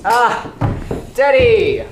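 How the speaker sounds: loud, cheerful call across the room; loud greeting call to a friend. Acted.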